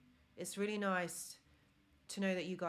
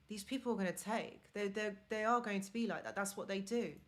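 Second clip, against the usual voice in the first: ordinary talk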